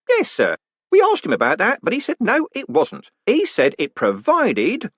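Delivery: high, bright-voiced jolly warder; cheerful warder. Warder reporting the prisoner's words to the condemned man, cheerfully. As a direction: play the polite prison warder chatting brightly.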